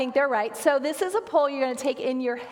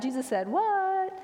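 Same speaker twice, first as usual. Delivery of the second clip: slow, with "what" stretched and soaring high; incredulous what; 7 semitones higher than usual. Shown as a demonstration. Pastor showing how to say the series title, "what" incredulous. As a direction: end on a long, high, disbelieving "what". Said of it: "you have to say it like that".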